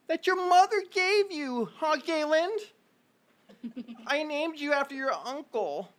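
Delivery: high, slow kvetching mother. Acted. Mother complaining to the caller, high and slow. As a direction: drag out the guilt trip. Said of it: "She goes".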